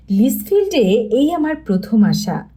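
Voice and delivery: plain reading voice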